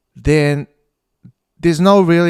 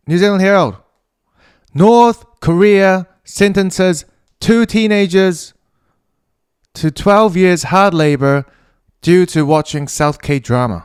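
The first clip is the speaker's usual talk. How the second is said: higher, louder headline announcement, slow in places; news headline read; 6 semitones higher, 6 dB louder, slower than usual. Acted.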